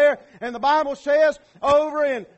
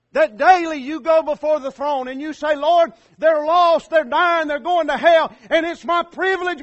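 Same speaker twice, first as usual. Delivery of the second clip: loud, raised, urgent preacher delivery; full-force preaching; 4 semitones higher, 7 dB louder than usual. Real, not acted. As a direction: preach it loud, pleading for the lost.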